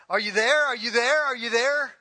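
put-on loud, rising calls of a worried child; daughters yelling. Little girls on bikes calling for their dad, loud anxious yell. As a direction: shout back for dad without looking. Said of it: "And they would yell".

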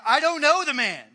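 angry oath, loud; swearing denial. Acted. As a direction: bark it out to get rid of them. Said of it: "denied it again with an oath, swearing".

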